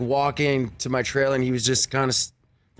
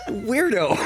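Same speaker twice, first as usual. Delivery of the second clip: laughing. Retold or imitated. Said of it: "I'm like".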